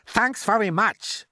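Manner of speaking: high, drawn-out irritated retort; snapping. A narrator's reading. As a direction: snap back sarcastically at the suggestion. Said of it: "snapped the head of the fire department".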